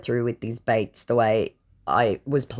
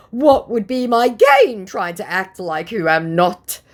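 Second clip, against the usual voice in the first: whiny voice; 8 semitones higher, 6 dB louder than usual. Retold or imitated. Scammer voiced.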